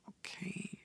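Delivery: whispered, barely there okay; confused whisper. Retold or imitated. Whispering a baffled okay to the front desk lady. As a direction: hush it, puzzled and unsure.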